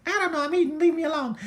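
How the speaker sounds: high-pitched whiny brush-off; grumpy eater. Acted. Yodi bothered while eating, high whiny voice. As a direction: whine it like a bothered little unicorn.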